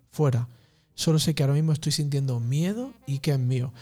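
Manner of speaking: ordinary delivery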